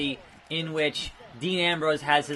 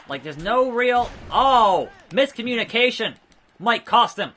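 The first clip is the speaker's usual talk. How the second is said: excited; 6 semitones higher, 6 dB louder than usual. Real, not acted. Reacting to in-game mistake.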